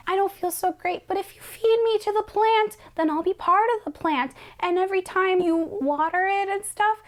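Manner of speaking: fragile last wish; faint dying Audrey. Retold or imitated.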